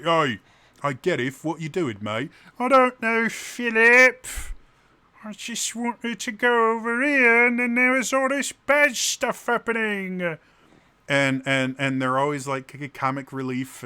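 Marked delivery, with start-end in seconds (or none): 0.0-2.3 matey Cockney character voice; good old Cockney boy; Philip. Acted. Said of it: "a good old Cockney boy". 2.6-10.4 very high, loud comic whine; high-pitched cartoonish plaintive voice; Gareth. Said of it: "Gareth talks like this".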